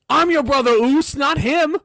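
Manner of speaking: high, loud mock yell; imitated shout. Jimmy shouting at Jey over Zayn, high mock shout. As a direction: belt it like a jealous brother. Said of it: "he's like".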